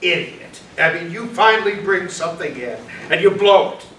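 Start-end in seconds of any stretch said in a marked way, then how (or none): none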